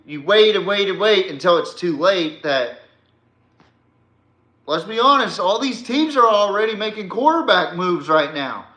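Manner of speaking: ordinary delivery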